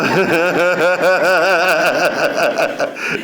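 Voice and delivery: long, high rolling laugh. Real, not acted. Speaker confessing to watching Jerry Springer, laughing hard. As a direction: laugh heartily at your own confession.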